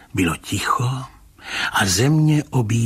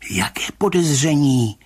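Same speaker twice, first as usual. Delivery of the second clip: higher-pitched, astonished question; amazed; 7 semitones higher, 4 dB louder than usual. A narrator's reading. Rybka taken aback by the commissioner's question, amazed. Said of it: "Užasl pan Rybka".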